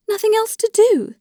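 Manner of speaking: very high, anguished repeated question; tearful disbelief. A narrator's reading. Dolly protests Alexei's resolve on divorce, in high-pitched tearful disbelief. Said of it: "she replied with tears in her eyes".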